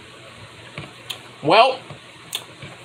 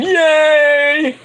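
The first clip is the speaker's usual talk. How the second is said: mock cheer; 9 semitones higher, 4 dB louder than usual. Cheering a tag team win.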